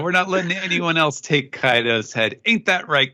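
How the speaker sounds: lightly voiced boastful rival; Kid. Host as Kid boasting to his crewmate, lightly cocky. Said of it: "So he says".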